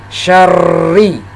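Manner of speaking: SYARRI with a long, pressed double r; doubled, pressed r. Shown as a demonstration. Teacher demonstrating tasdid to learners, pressed double r in syarri. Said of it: "membacanya ditekan suara kita".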